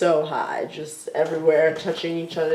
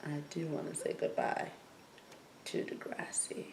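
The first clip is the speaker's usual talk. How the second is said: much softer, fading into a breathy whisper; hushed, teasing fade to a whisper; smirky whisper; 2 semitones lower, 20 dB quieter than usual. Acted. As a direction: say it like a sly secret. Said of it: "I'm trying to be, like, smirky".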